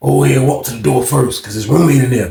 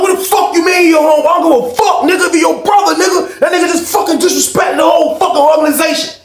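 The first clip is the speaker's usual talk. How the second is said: furious shout; 12 semitones higher than usual. Retold or imitated. Chris yelling at Jay about the organization, furious shout. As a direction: scream at him for betraying the gang.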